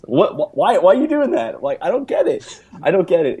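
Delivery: exasperated rant. Real, not acted. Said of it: "a good rant from you".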